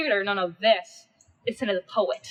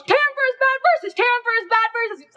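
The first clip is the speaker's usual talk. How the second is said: much higher and louder, yelling; frenzied shout; 13 semitones higher, 6 dB louder than usual. Acted. Mob yelling to tear Cinna apart, frenzied shout. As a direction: scream for blood like a lynch mob.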